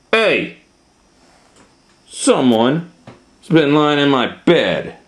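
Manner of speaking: loud, high indignant growl of complaint; an unhappy bear objecting loudly; displeased Papa Bear. Acted. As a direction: call out the complaint, put out and emphatic. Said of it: "he was not happy about this".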